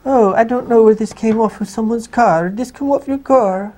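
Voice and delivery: put-on high, fussing old-nanny voice. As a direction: fuss like a flustered old nanny. Keeps the nanny voice going.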